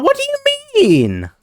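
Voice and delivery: very high, slow squeaky voice; leprechaun voice. Pretend. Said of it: "the leprechaun voice".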